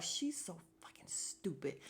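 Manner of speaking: hushed, mostly voiceless aside; disbelieving whisper. Retold or imitated. Host reacts to the clueless castmate, whispering in disbelief. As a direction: whisper it under your breath in disbelief.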